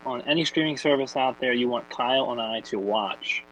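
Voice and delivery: ordinary delivery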